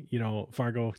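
ordinary talk, nothing marked

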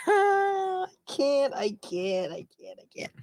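real high laughter breaking into words; cracking up. Real, not acted. Host cracks up reading the headline, helpless laughter. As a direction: laugh helplessly, trailing off weakly.

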